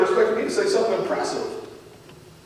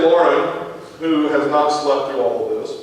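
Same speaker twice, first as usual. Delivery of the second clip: ordinary talk, nothing marked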